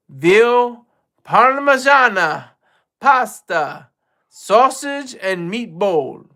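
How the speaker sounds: hammed-up Italian accent, each dish loud and high; put-on Italian accent for fun; playful Italian accent. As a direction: list the dishes in a stretched mock-Italian accent. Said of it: "I'm sorry for the accent".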